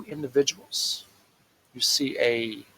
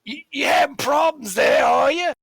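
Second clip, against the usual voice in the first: high, loud barkeep voice; teasing barkeep; 11 semitones higher, 6 dB louder than usual. Acted. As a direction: needle the fumbling customer loudly. Barkeep teases fumbling customer.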